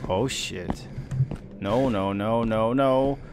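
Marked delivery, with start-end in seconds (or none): none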